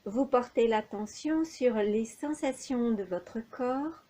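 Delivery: usual speaking voice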